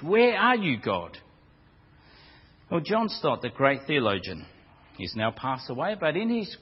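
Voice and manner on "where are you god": anguished cry. Retold or imitated. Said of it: "cry out to God in anguish".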